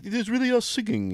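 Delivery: mock a pop singer in a clumsy Middle Eastern accent; bad Middle Eastern accent. Podcast host mocking the Milli Vanilli singers, in a bad Middle Eastern accent. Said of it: "my horrible, uh, like, Middle Eastern accent".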